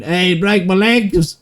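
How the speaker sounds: imitating a man wailing about his broken legs; mock scream. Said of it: "and then Nigel just out there screaming".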